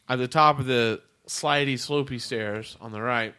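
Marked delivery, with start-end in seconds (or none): none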